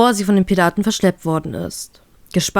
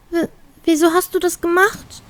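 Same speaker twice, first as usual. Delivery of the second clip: put-on girl's voice, much higher, tearful stammer; whiny, near tears; 10 semitones higher than usual. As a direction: stammer it out like she might cry. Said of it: "fragt sie weinerlich". (weinerlich, hohe Mädchenstimme)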